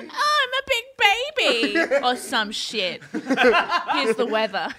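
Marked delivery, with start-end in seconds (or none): 0.0-1.8 high, laughing crybaby mockery; whiny baby voice. Mocking the witnesses as babies. 3.1-4.7 slow, laughing mock weather anchor; sarcastic newsreader. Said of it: "sarcastic newsreader".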